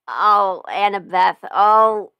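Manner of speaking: usual speaking voice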